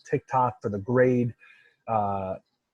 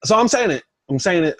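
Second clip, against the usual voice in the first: much higher, louder defiant announcement; proud louder admission; bold declaration; 7 semitones higher, 7 dB louder, faster than usual. Real, not acted. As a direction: declare it like a hot take. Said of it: "I'm coming out here and I'm saying it".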